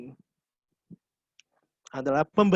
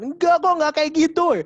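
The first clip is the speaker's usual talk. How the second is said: higher and louder, a sudden outburst; sudden high shout; 12 semitones higher, 7 dB louder than usual. Shown as a demonstration. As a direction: blurt it out, suddenly heated. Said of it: "tiba-tiba ngegas gitu kan".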